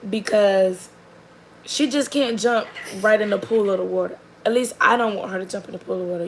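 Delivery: normal talking voice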